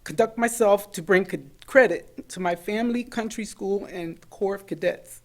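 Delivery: real tears pushing through the creed; choked up. Real, not acted. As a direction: fight back real tears as you read. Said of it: "promised I wasn't gonna cry".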